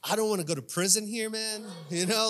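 normal talking voice

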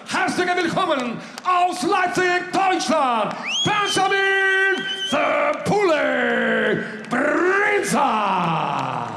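long stretched ring shout, high and slow; drawn-out name call. Acted. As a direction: draw out the fighter's name for the crowd. Announcer calls out fighter name.

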